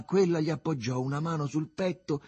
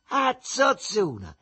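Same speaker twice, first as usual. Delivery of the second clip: high, loud, stretched insult; shouting outside; 9 semitones higher, 5 dB louder, slower than usual. A narrator's reading. Mother on the landing hollers at a neighbour, shouting. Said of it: "si mise a gridare verso fuori".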